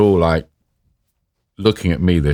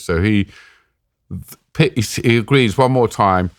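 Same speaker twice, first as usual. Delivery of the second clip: normal talking voice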